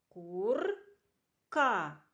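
Кур-ка split into two slow syllables; teacher spelling out a word for children. Shown as a demonstration. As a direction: stretch each sound of Кур-ка for the class. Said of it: "Який це звук?".

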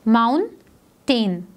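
slow "माउंटेन" as moun, pause, tain; split into syllables. Shown as a demonstration. Teacher dividing the last word for pupils, syllable by syllable. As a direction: break "माउंटेन" into two separate beats. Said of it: "दो syllables हैं".

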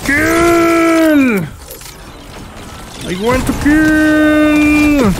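high, loud, drawn-out roar of kill; battle cry. Acted. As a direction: bellow it like a bloodthirsty brute, slow and stretched. Yelling during a game fight.

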